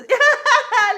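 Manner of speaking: loud burst of tickled laughter; shrieking laugh from tickling; ticklish laugh. Real, not acted. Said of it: "La zone des guilis".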